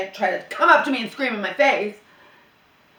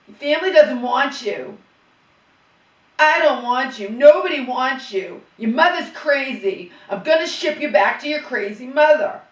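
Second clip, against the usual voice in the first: loud, harsh mock of father's taunts; father yelling at his daughter, loud and cruel; 4 semitones higher, 7 dB louder than usual. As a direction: spit out the father's words at the girl. Voicing father's cruel words.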